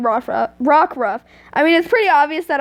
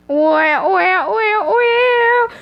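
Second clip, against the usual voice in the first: rising "wah" wails, climbing higher each time; mock musical "wah-wah" with the mouth; swooping comic imitation of romantic music; imitated music wail; 7 semitones higher, much slower than usual. Mimics romantic music with her voice.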